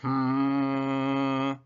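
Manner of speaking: sleepy robotic hum; snoring robot. A narrator's reading. Robot half asleep answering Rabbit, snoring hum. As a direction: hum like a dozing machine. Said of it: "snored Robot".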